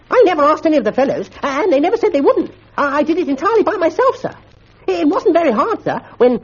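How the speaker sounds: very high, fast, stammering schoolboy; Bunter fibbing nervously. A narrator's reading. Bunter lies to Mister Quelch, high and flustered. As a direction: gabble the lie in a squeaky panic.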